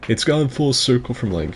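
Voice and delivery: usual speaking voice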